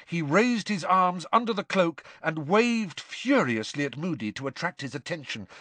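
ordinary delivery, nothing marked

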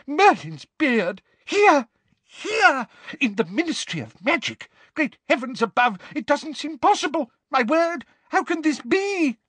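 high, breathless flustered exclamations; gasping Fudge. Acted. Shocked minister in pyjamas exclaims, high and breathless. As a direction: splutter in disbelief. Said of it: "was gasping as though he had just run miles".

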